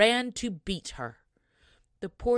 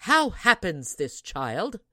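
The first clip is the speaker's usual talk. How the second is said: put-on a louder, firm mother asking her child; mother's voice; 9 dB louder than usual. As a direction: question the girl like a stern but curious mother. Mother questions her daughter.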